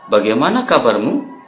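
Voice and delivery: usual speaking voice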